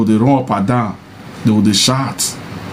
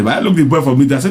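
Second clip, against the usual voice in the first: ordinary talk, nothing marked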